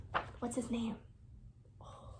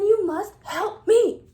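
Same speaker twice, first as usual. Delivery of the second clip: begging; 5 semitones higher, 9 dB louder, slower than usual. Acted. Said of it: "begged the king".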